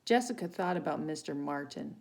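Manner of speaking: plain reading voice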